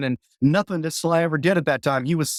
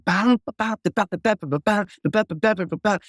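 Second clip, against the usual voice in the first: punchy "ba" syllables like slap bass; imitating his own slap bass solo; scatted bass groove; 3 semitones higher than usual. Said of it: "And I just start going".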